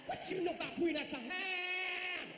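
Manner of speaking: high, slow, stretched-out crowing; drawn-out high mimicry; woman's exuberant holler. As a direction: imitate her crowing it, dragging out HAM. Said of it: "This is what I heard her say".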